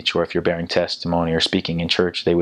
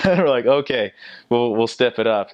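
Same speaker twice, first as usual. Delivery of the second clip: laughing; 5 semitones higher than usual. Retold or imitated. Laughing reply to teacher.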